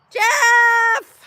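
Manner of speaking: loud, high shout of a name; excited call-out. Real, not acted. Streamer greeting a viewer in chat, excited shout. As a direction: call him out with delight.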